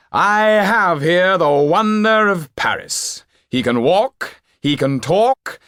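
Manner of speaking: a flamboyant stage magician working the crowd; showman magician. Acted. Topolain announcing to the nobles, high and loud showman voice.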